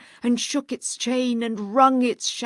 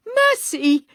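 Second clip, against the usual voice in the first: a high, loud cry for mercy; terrified plea; 10 dB louder than usual. Acted. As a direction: beg in sheer terror. Scrooge terrified on knees.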